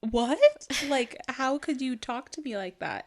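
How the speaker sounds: put-on hurt toddler. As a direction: play the stunned child after a yelling. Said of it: "he just looks at me like".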